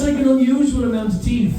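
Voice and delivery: ordinary delivery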